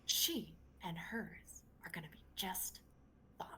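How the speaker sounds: soft, whispery granny; whispered assurance. Acted. Old woman assuring a girl she'll survive, whispered. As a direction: drop to a whisper, word by word. Granny menacingly ends her speech.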